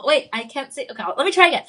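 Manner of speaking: usual speaking voice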